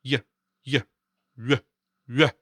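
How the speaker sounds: short isolated "y" syllables, moving into rounded lips; lip-rounding demo. Shown as a demonstration. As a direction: repeat "y" in short bursts, rounding into a ü glide. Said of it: "Make a y sound while rounding your lips".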